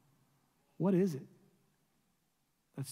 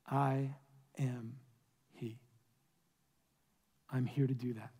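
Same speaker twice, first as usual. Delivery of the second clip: much softer and lower declaration; quiet, solemn; 5 semitones lower, 5 dB quieter than usual. A narrator's reading. Jesus speaking softly to the woman, solemn and quiet. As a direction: lay it down gently, face to face. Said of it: "looked her in the eyes, and said".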